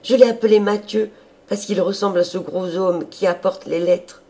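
put-on high, lively, childlike enthusiasm; delighted. A narrator's reading. Sister telling her brother about her pig, high and delighted. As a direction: chatter happily, coaxing at the end. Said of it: "d'un air ravi".

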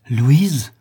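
astonished outcry; emotional cry. A narrator's reading. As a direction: exclaim the name with swelling emotion. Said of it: "s'écria, après quelques instants d'émotion croissante".

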